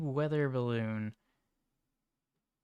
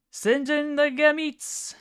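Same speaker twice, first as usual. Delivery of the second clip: high slow meme shout; 14 semitones higher, 6 dB louder, slower than usual. Pretend.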